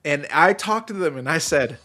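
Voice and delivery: ordinary delivery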